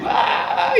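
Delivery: mock yell. Husband yelling at his wife in the dispute, mock slow shout. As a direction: imitate him bellowing, slowed right down. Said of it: "il criait comme ça".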